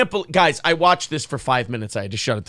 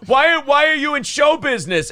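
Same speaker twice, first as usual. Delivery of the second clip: higher, mock-indignant demand; Kevin Brennan; 8 semitones higher than usual. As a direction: imitate an exasperated complainer, pitched up. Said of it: "he did the Kevin Brennan impression".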